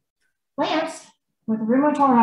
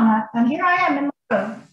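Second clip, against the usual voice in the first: usual speaking voice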